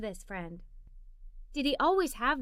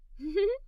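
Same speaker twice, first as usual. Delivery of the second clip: little giggle; 5 semitones higher than usual. Acted. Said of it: "I giggled a little".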